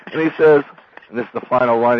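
ordinary talk, nothing marked

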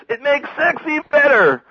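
very high, loud exasperated mock shout; Sam's retort. Sam knocks down Dewey's fears, high insistent shout. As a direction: shout the rebuttal at the top of the range. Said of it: "and then Sam goes".

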